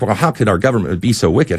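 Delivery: usual speaking voice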